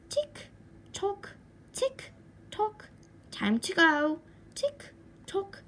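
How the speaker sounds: high, sing-song tick tock of a clock. Acted. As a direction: chime it like a nagging kitchen clock. Clock tells him time passing.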